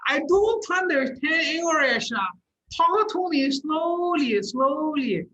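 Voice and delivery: put-on weird Chinese accent. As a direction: drag out a mock Chinese accent, begging them to slow down. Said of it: "the weird Chinese accent".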